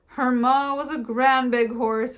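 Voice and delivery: put-on high, loud, slow old Irish farmer voice; exclaiming old farmer. A narrator's reading. Old Murphy exclaiming to the travellers, high and loud. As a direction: boom it out like a proud old horse trader. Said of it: "exclaimed Mister Murphy".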